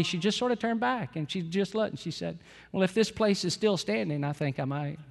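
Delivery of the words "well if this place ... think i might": a casual, offhand reply, much softer; nonchalant. Retold or imitated. Little daughter answering her dad about working here, offhand and soft. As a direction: toss it off lightly, as if it barely matters. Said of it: "just said very nonchalantly".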